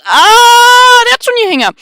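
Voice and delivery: panicked high clerk; scared clerk. Acted. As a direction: squeak in alarm.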